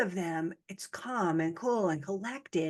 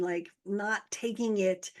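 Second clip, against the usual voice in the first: ordinary talk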